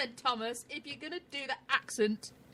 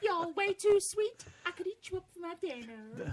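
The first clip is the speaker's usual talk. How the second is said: put-on British Tennessee accent; 6 semitones higher than usual. Playing along with co-host's accent request, British Tennessee. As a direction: stretch it out, Tennessee by way of Britain. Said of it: "Do a British Tennessee accent".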